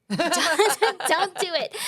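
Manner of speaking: high and loud, laughing through the warning. Retold or imitated. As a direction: laugh it out as a half-joking warning. Mentor warns against social work.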